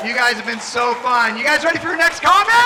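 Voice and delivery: loud, rising emcee shout; real shout to a crowd, building; hyping the crowd. Real, not acted. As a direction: rev up the audience for the next act. Calling up crowd for next comic.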